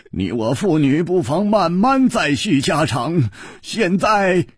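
short chuckle, then lifted voice; laughing genially. A narrator's reading. King puts off family chat with his daughter, laughing genially. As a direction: chuckle first, then speak up cheerfully. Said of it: "龟兹王笑着说".

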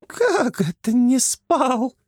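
high, slow, hammed-up hag voice; mock kikimora. Франси plays the kikimora to tease Flappy, high mock voice. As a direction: play along as the monster. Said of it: "подыграла Антону Франси".

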